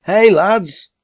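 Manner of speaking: loud, high call across the road; hollering to his comrades; shout at top of voice. A narrator's reading. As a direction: hail your mates from afar. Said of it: "he shouted at the top of his voice".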